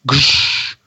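breathy whooshed "gush" sound effect; imitated gushing sound. Imitating a ghostly gush from the ceiling above, whooshed. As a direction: mimic water gushing from the ceiling. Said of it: "I hear gush".

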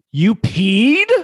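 slow, rising incredulous ask; shocked question. Acted. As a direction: ask it in disbelief.

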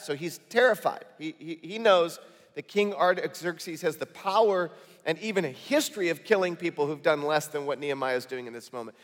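ordinary delivery

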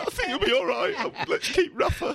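high put-on voice mocking the boss; squeaky mock manager voice; imitating a manager. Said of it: "He's like".

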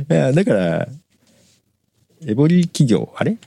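ordinary talk, nothing marked

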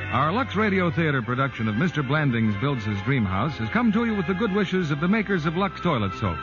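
usual speaking voice

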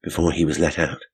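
usual speaking voice

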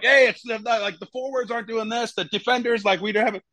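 high, whiny protesting teammates; complaining teammates. Retold or imitated. Defenders complaining to coach at halftime, high whiny protest. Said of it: "everyone's like".

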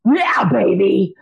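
put-on slow, relishing yeah baby; yeah baby energy. Shown as a demonstration. Coach naming his passion energy, slow and relishing. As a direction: savour it like a win. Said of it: "I call that energy".

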